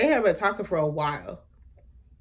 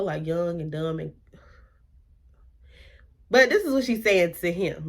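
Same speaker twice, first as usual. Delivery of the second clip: ordinary delivery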